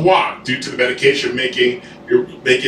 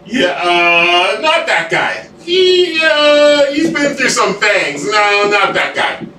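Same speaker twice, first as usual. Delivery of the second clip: put-on wary tough teen; 6 semitones higher, much slower than usual. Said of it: "that just says".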